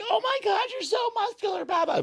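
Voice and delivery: very high squeaky mock-girl voice; gushing girl. Trainer retelling how a girl admired him, mock high-pitched gushing. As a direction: imitate a girl freaking out, way up in falsetto. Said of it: "and the girl's like".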